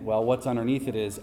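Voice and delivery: normal talking voice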